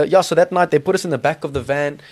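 usual speaking voice

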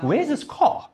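high, bewildered question to himself; puzzled surprise. Retold or imitated. Preacher wondering where the paramedic came from, high puzzled. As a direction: sound baffled, voice jumping up.

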